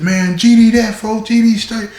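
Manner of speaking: high called-out yell from a cell; yelling from the hole. Retold or imitated.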